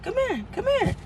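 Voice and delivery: high, quiet coaxing come here; stepdad beckoning. Retold or imitated. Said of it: "and just".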